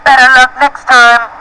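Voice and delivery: high-pitched quoted yell, little added volume; mock yell. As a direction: quote the racer's call in a high voice. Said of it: "did Alan yell".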